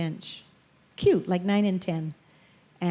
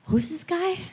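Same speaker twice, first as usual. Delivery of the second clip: puzzled congregation; 11 semitones higher than usual. Retold or imitated.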